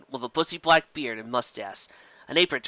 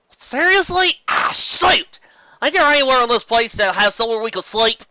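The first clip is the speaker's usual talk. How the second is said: much higher, loud exasperated outburst; frustrated Applejack; 9 semitones higher, 8 dB louder than usual. Acted. Applejack loudly protests no vacancies. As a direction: blurt out disbelief.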